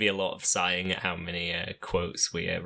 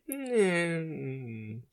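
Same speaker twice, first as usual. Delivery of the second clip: unimpressed hum; 8 semitones higher than usual. Retold or imitated.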